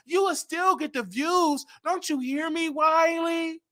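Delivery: high, insistent scolding voice, dragged out slowly; voicing Tasha K. Tasha K on the phone telling Wiley to stop, high insistent scold. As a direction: lecture him high and pushy, stretching the words.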